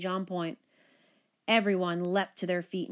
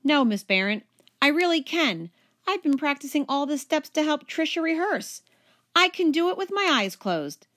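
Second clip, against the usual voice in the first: eager insisting; 8 semitones higher, 4 dB louder than usual. A narrator's reading. Richie insists he can dance.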